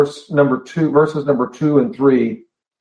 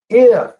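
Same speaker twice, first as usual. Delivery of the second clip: "If" punched high and louder; stress on If; 10 semitones higher, 5 dB louder than usual. Shown as a demonstration. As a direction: hit "If" hard, high and isolated. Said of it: "look at that first word there".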